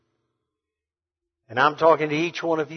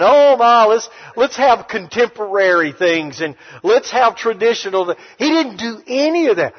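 louder, driving preacher's cadence; preaching at full force; 3 semitones higher, 5 dB louder than usual. Acted. Preacher driving home his point to the church, full force. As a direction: build it up for the congregation.

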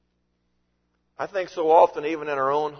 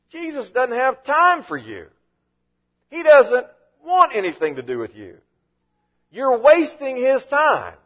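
harsh scolding, higher and louder; mock rebuke; 8 semitones higher, 6 dB louder than usual. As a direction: scold the parents as a gatekeeping disciple. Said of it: "they openly rebuked the people".

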